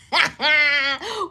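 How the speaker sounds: loud high burst of laughter; laughing. Real, not acted. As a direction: let out a big laugh. Laughs at her own joke.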